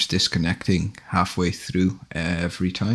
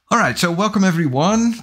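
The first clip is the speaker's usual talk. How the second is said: upbeat greeting; 12 semitones higher, 4 dB louder than usual. Real, not acted. Streamer greets arriving viewers.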